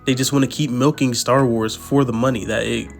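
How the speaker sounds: ordinary talk, nothing marked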